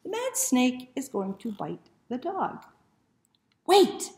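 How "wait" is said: high, loud stop; shout. Acted. The dog shouts to stop the snake.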